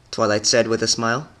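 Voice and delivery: plain reading voice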